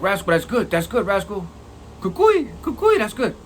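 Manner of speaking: cheering on. Retold or imitated. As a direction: cheer him on from the doorway. Cellmate cheering from the door.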